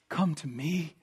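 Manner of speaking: "Come to me." said softly and low, almost under the breath; soft invitation. Acted. Preacher gently voices Christ's call to his listeners, hushed. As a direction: drop to a hushed, tender come to me.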